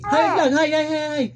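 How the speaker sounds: high, loud soothing sing-song. Real, not acted. Father soothing his crying baby, high sing-song baby talk. Making baby greet vlog.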